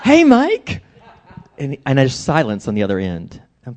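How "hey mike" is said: very high mock-cheerful hail; put-on friendly greeting. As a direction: greet him far too brightly, up high. Acted greeting to dreaded caller.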